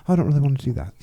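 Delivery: light mock English accent for his wife; a hint of an English lady's voice. Imitating his wife's English accent. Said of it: "I like when you do her English accent".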